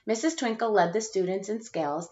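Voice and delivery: ordinary delivery, nothing marked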